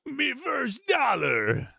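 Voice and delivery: high, drawn-out cartoon crab wail; Mr. Krabs. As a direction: stretch it into a raspy cartoon cry. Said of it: "that's an insane Krabs impression".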